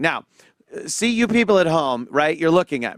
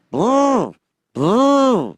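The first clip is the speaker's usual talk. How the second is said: loud, high booming doom beats; dramatic boom; 5 semitones higher, 4 dB louder than usual. Retold or imitated. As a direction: hit it like a drum of doom.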